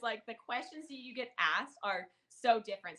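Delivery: normal talking voice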